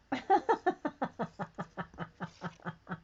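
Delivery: long rippling giggle; amused laugh. Real, not acted. Chatting with viewers while drawing, long giggle. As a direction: laugh in a long run that dies down.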